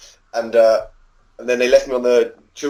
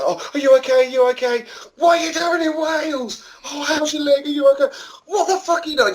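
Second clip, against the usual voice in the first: very high, squealed mock-mum voice; hammed-up shrieky worried mum; panicking mum. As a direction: imitate a frantic mother firing questions in falsetto. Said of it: "My mum ... she was like".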